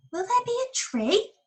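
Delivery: a little bird asking hopefully; small bird voice. Acted. Bird character asks.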